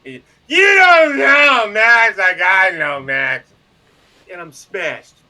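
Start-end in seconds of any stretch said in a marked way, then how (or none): 0.5-3.5 put-on slow, slurred drunken shout, loud and high. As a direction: bellow it like a wasted barfly. Drunk guy on bar stool.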